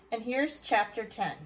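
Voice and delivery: ordinary delivery, nothing marked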